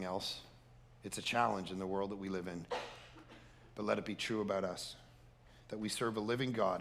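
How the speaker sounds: soft, steady prayer voice; closing prayer. Acted. Pastor praying over congregation, soft and earnest. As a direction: pray it gently over the room.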